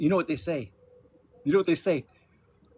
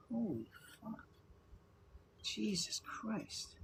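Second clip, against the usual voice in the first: breathy, higher, much softer aghast; stunned disbelief; 8 semitones higher, 14 dB quieter, much slower than usual. Real, not acted. Reading abuse rumours in chat, stunned hushed disbelief. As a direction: gasp it out, floored.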